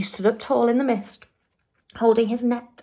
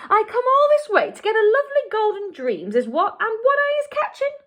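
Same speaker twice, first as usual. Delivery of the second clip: distressed outburst; 13 semitones higher, faster than usual. A narrator's reading. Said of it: "more and more distressed".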